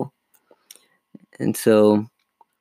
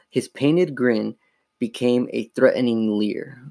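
plain reading voice

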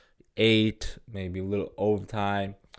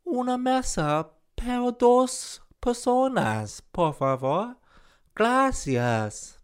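high, slow English-tourist Spanish; English accent in Spanish. Pretend. Englishman orders a table for two in English-accented Spanish. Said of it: "I'm an Englishman trying to talk in Spanish".

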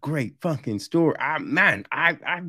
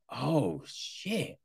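quiet stunned curse; hushed shock; 10 dB quieter than usual. Retold or imitated. Viewer stunned by a twist, hushed shocked curse. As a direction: breathe it out, floored.

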